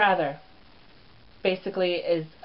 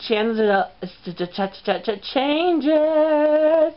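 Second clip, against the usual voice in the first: stuttered catchphrase; 8 semitones higher, 3 dB louder, much slower than usual. Real, not acted. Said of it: "that little fuck up I just did".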